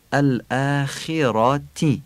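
الاخراتی syllable by syllable, clear "i" in khi and ti; clear Arabic kasra. Shown as a demonstration. Teacher showing Arabic kasra in الاخراتی, slow clear i vowels. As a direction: slowly give الاخراتی two crisp i vowels. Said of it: "کسره ها رو باید فصیح و عربی بگیم".

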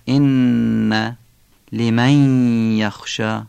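ان and لمن hummed long through the nose; nasal ghunnah. Shown as a demonstration. Teacher showing both ghunnahs in ان, لمن یخشی, held nasal sound. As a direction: hold the doubled n of ان and the nasal glide of لمن یخشی.

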